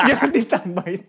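loud high laugh into words. Real, not acted. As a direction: laugh while begging them to stop. Laughs at added pun.

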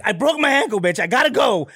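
high, urgent brush-off; lightly acted exasperation; exasperated. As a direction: get away from her fast. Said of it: "I was like".

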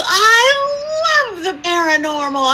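high, slow, drawn-out gush; imitated gushing. Client thrilled about the paranormal, imitated gushing. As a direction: stretch it out high with mock delight. Client gushes in retold phone call.

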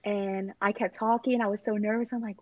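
ordinary delivery

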